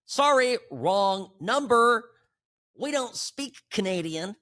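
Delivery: high, loud and slow prissy brush-off. Retold or imitated. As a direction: brush them off in a squeaky snooty voice.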